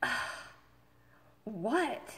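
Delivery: exasperated huff, then a curt question; retold attitude. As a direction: huff at the caller as if bothered. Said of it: "she gets an attitude".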